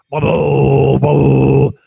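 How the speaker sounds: signal noise. Retold or imitated.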